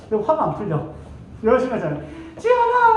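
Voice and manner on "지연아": much higher, louder whining call; hammed-up whiny boyfriend; whiny call to girlfriend. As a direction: cry out her name like a needy boyfriend. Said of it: "막 이러고 있는 거야".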